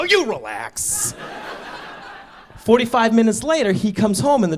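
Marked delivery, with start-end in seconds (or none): 0.0-1.1 slow, sulky comeback; lightly sulking mock comeback; petty retort. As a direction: drag out a childish retort.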